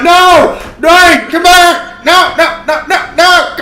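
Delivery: high, loud, drawn-out pleading cries; desperate wail. Acted. Restrained fan cries for Dwayne to return, desperate wail. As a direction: wail hysterically while being dragged down. Wails while being restrained.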